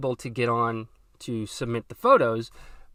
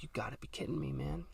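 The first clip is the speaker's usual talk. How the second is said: seething whisper; 16 dB quieter than usual. Retold or imitated. Furious at the cancellation.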